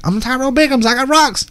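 squeaky jittery hustler; Tyrone Biggums. Retold or imitated. Stranger pitching to Torin in a high crackhead voice. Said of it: "like Dave Chappelle".

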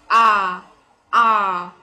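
British "A" as a long ah, no r sound. Shown as a demonstration. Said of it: "British accent is only pronounced".

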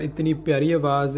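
ordinary talk

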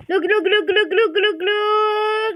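loud, slow "glug-glug" vocal effect; glugging water imitation. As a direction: imitate water pouring, drawing out the end. Imitates water being poured.

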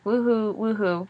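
half-hearted woohoo; whooping. A narrator's reading. Fun dad celebrating himself, mildly whooping. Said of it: "Whooping it up".

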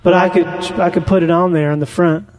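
ordinary talk, nothing marked

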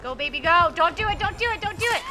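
loud, high and rising urgency; panicked gamer cheering and warning; frantic urging. Real, not acted. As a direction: urge a teammate on, building toward a shout. Frantic as killer chases teammate.

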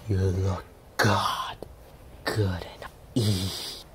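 low, slow, soft sinister whisper; a shark eyeing its lunch; low creepy hissing whisper; menacing shark. A narrator's reading. Shark speaks.

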